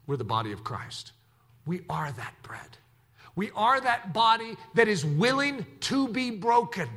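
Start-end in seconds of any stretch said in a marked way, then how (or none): 0.1-2.8 soft whispered declaration. Real, not acted. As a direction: whisper it like a secret truth. Intimate point in sermon.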